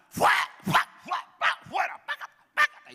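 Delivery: high, loud mock shouting of fuera; hammed-up frantic exorcists yelling; mock exorcism shouts. Crowd striking a possessed person. (gritos burlones de exorcismo)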